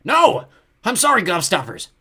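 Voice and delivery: high, loud panicked apology; desperate goblin cry; frantic goblin. A narrator's reading. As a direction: plead while being held captive. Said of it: "still writhing".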